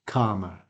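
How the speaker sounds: calmer as KAH-muh; received pronunciation. Shown as a demonstration. Teacher giving the dictionary form, received pronunciation. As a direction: say calmer with the long ah of arm. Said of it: "received pronunciation, which is the same as arm, art".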